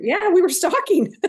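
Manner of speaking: loud, high, real laughing confession; laughing admission. Real, not acted. Fair manager admits stalking the carnival, laughing. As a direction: own up through a burst of laughter.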